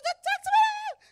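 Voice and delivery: high, loud, drawn-out mock shriek; angry shouting. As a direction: act out an indignant outburst. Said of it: "shouting and crying".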